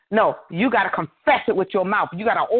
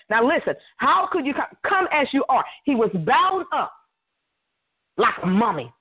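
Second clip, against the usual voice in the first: higher, rising preacher's climb; preaching at full force; 6 semitones higher than usual. Real, not acted. Preacher urging the congregation to move, full force. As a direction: drive the point home to the congregation.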